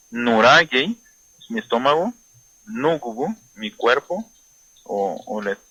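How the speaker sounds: usual speaking voice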